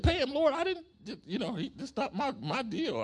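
can't believe what the Lord asked; shocked protest. Retold or imitated. Preacher protesting to the Lord, high shocked cry.

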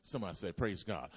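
rally the room to answer back; call to congregation. Acted. Call and response.

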